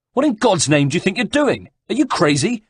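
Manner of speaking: high-pitched, incredulous alarm; alarmed yell. A narrator's reading. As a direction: cry out like an alarmed coachman. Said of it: "yelled Checo's driver, alarmed".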